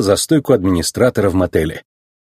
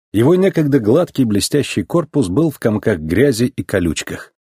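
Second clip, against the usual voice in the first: ordinary delivery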